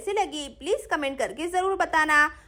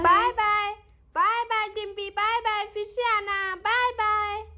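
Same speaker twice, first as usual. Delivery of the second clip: put-on children calling goodbye; 4 semitones higher than usual. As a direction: call out in a high sing-song child voice. Children waving goodbye to a friend. (बच्चों की ऊँची, लंबी खिंची विदाई)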